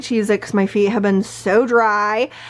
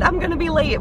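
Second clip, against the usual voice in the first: higher, mock-panicky fretting; anxious fretting; 7 semitones higher, much faster than usual. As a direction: fret it out like an anxious worrier. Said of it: "I'm just an anxious person".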